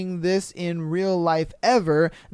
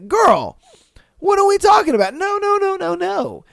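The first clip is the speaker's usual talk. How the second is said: much higher, louder shocked squeal of no; outraged disbelief; 13 semitones higher, 6 dB louder than usual. Real, not acted.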